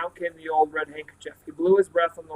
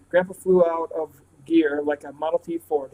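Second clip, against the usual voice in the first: ordinary delivery, nothing marked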